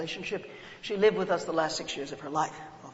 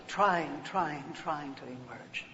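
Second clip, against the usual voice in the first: fading; 4 semitones lower, 6 dB quieter, slower than usual. Acted. Image of bird emerging.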